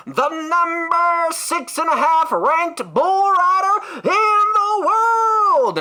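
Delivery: drawn-out, high booming announcer voice; rodeo announcer. Retold or imitated. Cowboy-hat host hyping the rodeo crowd, slow booming announcer. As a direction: stretch every word like a hype announcer. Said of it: "last but not least".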